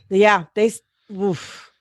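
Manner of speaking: usual speaking voice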